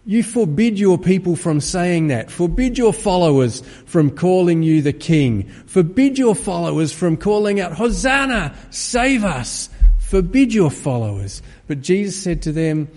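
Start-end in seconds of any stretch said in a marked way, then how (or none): 0.0-11.4 raised, louder indignant demand; angry rulers. Retold or imitated. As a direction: scold him as offended rulers would. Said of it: "the rulers became angry".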